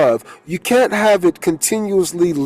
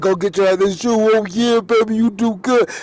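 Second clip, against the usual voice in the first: put-on grandpa praising baby; 4 semitones higher than usual. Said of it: "going to the baby and being like".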